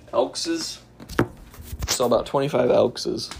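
"elkses" as a deliberate silly plural; joke plural. Shown as a demonstration. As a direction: say "Elkses" with a jokey extra -es on elk. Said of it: "I love putting extra S's".

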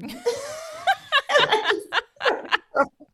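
a high laugh tumbling down. Real, not acted. Laughing at husband's pregnancy-test surprise, high and falling. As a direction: laugh out the absurdity.

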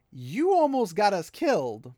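angry accusation. Retold or imitated. Crowd yelling at the outsiders, angry and slow. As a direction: throw the blame at them, slowly. People angry at near disaster.

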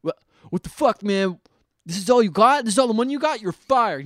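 imitated angry telling-off; mock yelling. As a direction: chew out a useless partner, acted out. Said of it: "he yells at his guy afterwards".

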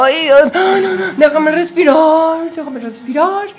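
high, loud, strained plea for air; exasperated gasping plea. Acted. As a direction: beg for room to breathe, overwhelmed. Acting a smothered dragon partner.

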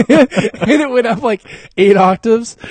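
high, loud, slowed by laughter; cracking up while talking; laughing. Real, not acted. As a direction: talk through a big laugh. Laughing about his own squeal.

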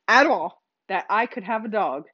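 usual speaking voice

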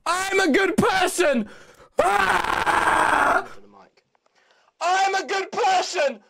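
high, slow shouted scream; stretched-out scream with a final ah; screaming into the mic. Real, not acted. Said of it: "just scream into the mic".

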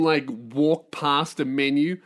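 ordinary delivery

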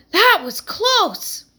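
breathless gasp, high and slow. Acted. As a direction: gasp it out in relief. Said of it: "gasped Heidi".

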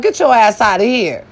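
ordinary delivery

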